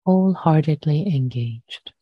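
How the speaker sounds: unhurried, measured meditation voice with long pauses; guided meditation. Real, not acted. Meditation teacher inviting listeners to settle their posture, calm and unhurried. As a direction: guide gently into stillness, leaving long silences.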